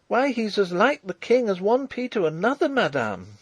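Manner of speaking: put-on high, stubborn girl's protest; shaken but obstinate. A narrator's reading. Farm girl contradicting the marshal, obstinate. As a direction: protest in a high voice, holding firm. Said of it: "a little shaken, but still obstinate".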